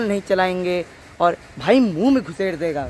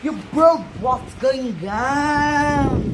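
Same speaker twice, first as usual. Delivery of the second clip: stretched, slow fake English accent; 5 semitones higher, much slower than usual. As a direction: put on a show-off English accent. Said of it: "फालतू का अंग्रेजी accent". (बनावटी अंग्रेज़ी accent)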